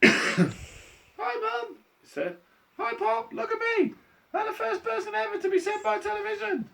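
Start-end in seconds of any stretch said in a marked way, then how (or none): 0.0-1.8 put-on extremely high little boy squeak; piercing tiny cartoon voice; tiny squeaky voice. A narrator's reading. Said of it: "A tiny little voice no louder than the squeaking of a mouse". 2.8-6.7 very high, excited mouse squeak; tiny, high, boastful squeak; tiny squeaky voice. A narrator's reading. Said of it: "A tiny little voice".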